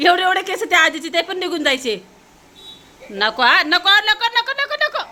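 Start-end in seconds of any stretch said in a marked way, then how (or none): 3.1-5.0 rising high repeated no; urgent no. Real, not acted. Mother stopping the girl pulling hair, high urgent. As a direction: stop her, voice climbing high.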